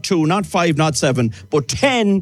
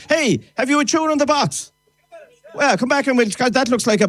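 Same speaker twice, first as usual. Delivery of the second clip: calling out; 9 semitones higher than usual. Real, not acted. Host calls to boy with box.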